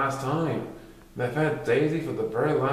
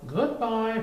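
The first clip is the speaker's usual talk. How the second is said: calling out; 10 semitones higher than usual. Acted. Teacher calling after them.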